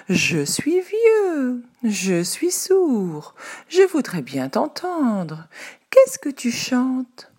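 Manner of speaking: slow, high, quavering fake old voice; sly fox feigning age. A narrator's reading. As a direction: pretend to be old and deaf. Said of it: "le malin renard".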